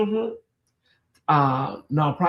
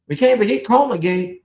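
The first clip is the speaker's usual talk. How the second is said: ordinary delivery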